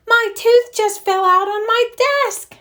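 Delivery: high, loud excited cry. A narrator's reading. Francine to the class, crying out excitedly. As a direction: cry it out like a thrilled girl. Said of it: "she cried".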